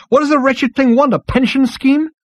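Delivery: very high, exasperated disbelief; high-pitched outraged question; exclaimed. A narrator's reading. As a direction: exclaim in incredulous scorn. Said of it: "exclaimed Zaphod".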